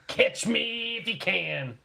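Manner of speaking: high, slow sing-song taunt; teasing taunt. Pretend. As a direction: taunt them playfully, drawn out. Mock taunting the taxman.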